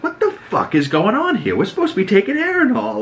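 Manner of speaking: high-pitched mock outrage, not shouted; indignant disbelief. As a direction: rush it out as a high, incredulous protest. Acts out Gawain's baffled outburst.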